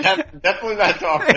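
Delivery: laughing. Real, not acted. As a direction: giggle your way through it. Laughing along at the joke.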